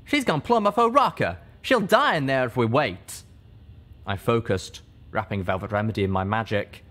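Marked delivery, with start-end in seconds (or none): 0.0-3.2 fierce. Acted. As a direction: snap it at her, alarmed and fierce. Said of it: "He spun to me fiercely".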